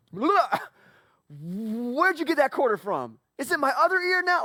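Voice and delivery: put-on high, loud, slow wide-eyed kid voice; amazed little kid. Child amazed at the quarter trick, high kid voice. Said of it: "And your kid's like".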